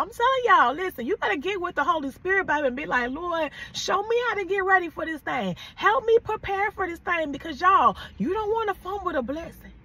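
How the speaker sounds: rising sermon fervor; fired-up preaching. Acted. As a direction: preach it hard to the crowd. Preacher builds to full force.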